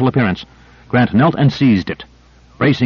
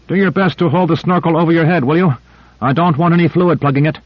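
higher, brisk technician; Owens voice; 7 semitones higher than usual. Acted. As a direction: give a practical order.

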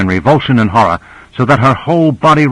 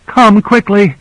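high-pitched alarmed cry, moderately raised; narrator's contained scream, pitched way up; 10 semitones higher, 3 dB louder than usual. A narrator's reading. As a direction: cry out for urgent help. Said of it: "She screamed".

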